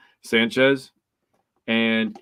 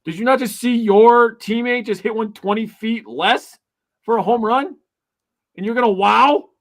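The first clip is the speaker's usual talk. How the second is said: much higher, louder, outraged disbelief; hammed-up indignation at the pitcher, pitched way up; indignant retort; 11 semitones higher, 6 dB louder than usual. Fan mocking a pitcher who wowed at a homer, high, loud indignation. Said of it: "And it's like".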